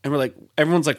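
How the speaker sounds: usual speaking voice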